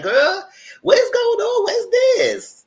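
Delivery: very high, loud imitation of indignation; outraged mock shriek. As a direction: shriek it like a scandalized mother. Acting out embarrassed mother confronting someone.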